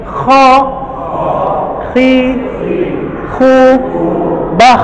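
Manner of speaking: Kha, khi, khu, bah with rasping air; breathy kh drill. Shown as a demonstration. Teacher modelling breathy kh letters for students. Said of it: "nafas yang kebuang".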